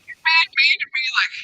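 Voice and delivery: high, whining complaint; whiny sore loser. Retold or imitated. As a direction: whine like a crybaby who lost. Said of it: "starts screaming to everyone that's watching".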